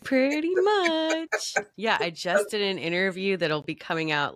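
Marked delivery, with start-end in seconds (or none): 0.0-1.5 amused. Real, not acted.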